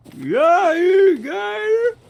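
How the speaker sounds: very high, slow screaming yell; shrieking battle cry. Real, not acted. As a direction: scream it out long. Attacking an alligator in game.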